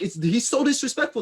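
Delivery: high-pitched, indignant complaint; indignant protest. Acted. Acted protest over being paused.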